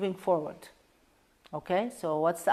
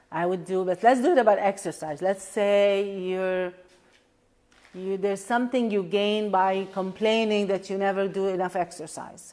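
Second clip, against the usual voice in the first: normal talking voice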